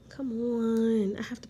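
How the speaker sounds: breathy whisper to self. Real, not acted. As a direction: whisper it under your breath. Muttering to herself.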